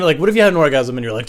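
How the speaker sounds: ordinary talk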